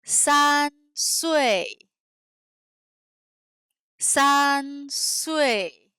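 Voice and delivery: "San sui" with level San, falling sui; slow, distinct Chinese tones on San sui; Chinese syllable demo. Shown as a demonstration. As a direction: hold San flat, then drop sharply on sui. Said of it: "je vais prononcer des syllabes chinoises".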